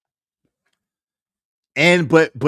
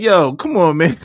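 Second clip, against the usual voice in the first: high, louder protest breaking into a laugh; exasperated plea; 3 semitones higher, 6 dB louder than usual. Retold or imitated. Husband pleading with suspicious wife, high exasperated protest. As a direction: plead with raised pitch, half amused.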